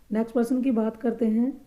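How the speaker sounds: ordinary talk, nothing marked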